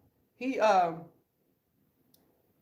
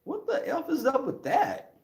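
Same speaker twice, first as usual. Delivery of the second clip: friend's disbelief; 9 semitones higher than usual. Retold or imitated. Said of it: "my friend was like".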